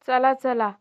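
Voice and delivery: loud hurry-up call; urging call. Shown as a demonstration. Teacher hurries the children along, loud. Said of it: "repeat drill, first take".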